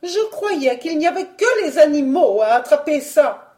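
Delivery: high, indignant objection; balking. Acted. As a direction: protest his excuse in a high voice. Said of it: "regimbait la baronne".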